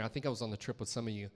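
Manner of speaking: normal talking voice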